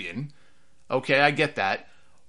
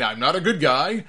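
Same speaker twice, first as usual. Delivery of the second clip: quoting Roman; 2 semitones higher, 6 dB louder than usual. Retold or imitated. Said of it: "He again used the line".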